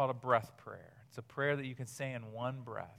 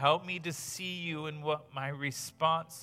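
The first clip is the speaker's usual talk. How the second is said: breath prayer. Real, not acted. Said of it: "let me pray now".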